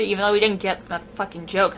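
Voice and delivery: ordinary talk, nothing marked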